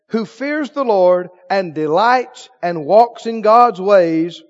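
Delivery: ordinary talk, nothing marked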